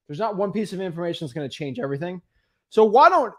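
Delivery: ordinary talk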